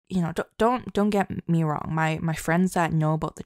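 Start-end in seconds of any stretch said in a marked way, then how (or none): none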